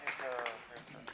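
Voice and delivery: faint, far-off echo of the mayor's last word; stadium echo. Acted. Echo of the mayor's speech across the ballpark, distant and faint. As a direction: let it bounce back quietly off the empty stands.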